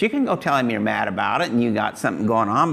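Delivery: ordinary talk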